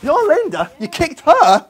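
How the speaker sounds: jaw-dropped outrage at the reveal; shocked disbelief. Acted. Presenter learning Linda did the kicking, loud shocked disbelief.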